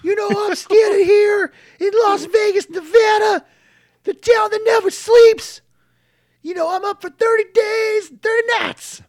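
booming gravel-throated promo shout, high and loud; wrestling promo. Retold or imitated. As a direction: bellow it like Scott Steiner cutting a promo. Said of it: "I'm throwing my voice out already".

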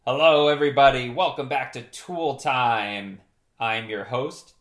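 big, loud show opener; TV host greeting. Acted. Host welcoming viewers back to Tool Time, loud presenter greeting. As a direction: launch the show with a booming welcome.

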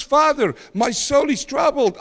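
usual speaking voice

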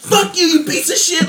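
very high, drawn-out retold screech; mock screaming. Woman cursing him before driving off, mock scream. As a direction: imitate a screaming driver, pitch shot way up. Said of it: "screaming at the doors".

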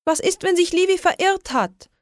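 high, anxious question; girl fearing her kitten is lost, desperate; desperate worry. A narrator's reading. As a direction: fret that the cat is lost. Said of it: "sagte ich verzweifelt".

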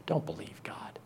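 hissed whisper of temptation; the serpent whispering to Eve; serpent's whisper. Acted.

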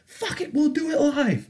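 high-pitched, strained mock yell; Bill O'Reilly rant. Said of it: "It's like a Bill O'Reilly style rant".

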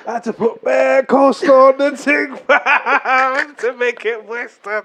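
high, loud, laughing; laughing through the story. Real, not acted. Laughing at the coleslaw.

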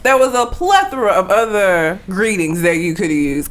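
normal talking voice

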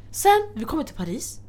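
usual speaking voice

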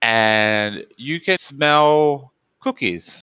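ordinary delivery

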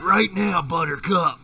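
high loud demand; mock-stern dog call. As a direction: snap the dog to come now. Calling the dog.

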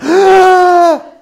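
loud, high, drawn-out mock gasp; shocked collective gasp. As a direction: gasp in horror like a whole roomful. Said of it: "everybody in the place went".

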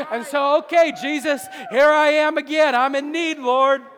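loud, high, pleading cry to Jesus; shouted confession. Acted. A sinner shouting his need to Jesus at the altar, loud and high. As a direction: cry it out at full voice like a broken sinner.

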